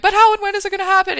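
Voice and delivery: high, loud, rushed alarm; mock panic. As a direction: act as if everything's on fire. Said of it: "you feel like everything's on fire".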